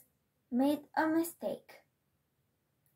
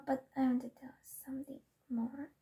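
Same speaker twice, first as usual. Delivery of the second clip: fast unvoiced whisper; 6 dB quieter, much faster than usual. Real, not acted. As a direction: rush it out in a whisper. Quick whispered aside.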